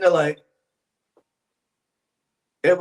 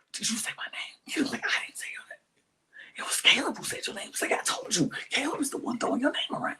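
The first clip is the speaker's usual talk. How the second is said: whispering; 5 semitones higher, 10 dB quieter, faster than usual. Retold or imitated. Retelling whispered plotting.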